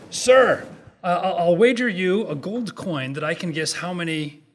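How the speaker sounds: loud, high-pitched hail on "Sir"; calling out. Acted. Adam Smith hails a shepherd with a wager, calling out. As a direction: hail a stranger loudly, then ease off.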